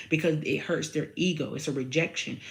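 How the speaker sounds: ordinary talk, nothing marked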